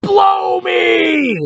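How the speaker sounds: very high loud shriek; scream. Acted. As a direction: fling it back while running off. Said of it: "he screamed over his shoulder".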